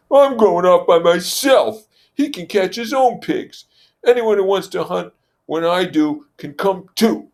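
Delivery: Jack's voice. Acted. Jack telling the assembly he's leaving. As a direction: storm off with wounded pride.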